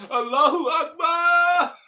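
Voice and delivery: high, slow reenacted shout; retold scream. Retelling his hallway joy, shouting high and slow. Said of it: "I screamed that shit in the hallway".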